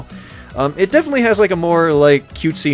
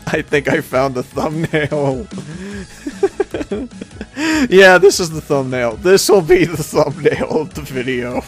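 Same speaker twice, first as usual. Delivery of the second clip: amused. Real, not acted. Laughing at gallery picture.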